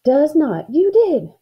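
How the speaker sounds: put-on high, loud childish contradiction; squabbling. A narrator's reading. Girl arguing with her sister, squabbling. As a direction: fire back a stubborn accusation.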